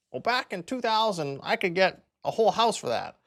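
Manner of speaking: lightly mocking a viewer grumbling that houses used to be cheap; mock commenter. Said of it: "I can hear it already, the comments".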